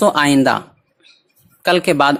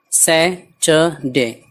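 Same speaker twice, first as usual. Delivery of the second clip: Saturday very slowly, in three separate pieces; syllable by syllable; much slower than usual. Shown as a demonstration. Teacher breaking down a weekday, Saturday syllable by syllable. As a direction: split Saturday into sai, ta, de with gaps. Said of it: "इन सबको मिला देना".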